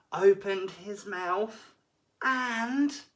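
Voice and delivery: slow, rising suspense build. A narrator's reading. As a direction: draw it out before the bite. Suspense building before crunch.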